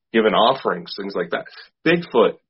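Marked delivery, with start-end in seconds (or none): none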